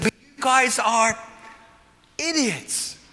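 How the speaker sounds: high, loud mock scolding, drawn out with a pause before the last word; paraphrased loud rebuke. Preacher voicing Paul's rebuke to the Corinthians, loud scolding. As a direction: stretch it out, then land the insult high and loud.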